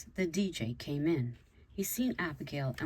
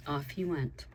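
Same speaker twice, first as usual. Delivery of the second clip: usual speaking voice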